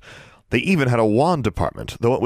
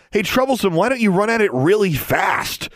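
mocking suggestion; 12 semitones higher than usual. A narrator's reading. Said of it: "suggested Wade".

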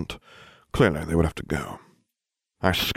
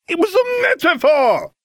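high, shrieking shout, slow and loud; furious shout; 5 dB louder than usual. Acted. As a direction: scream it so it echoes round the trunk. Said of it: "Hermione shouted".

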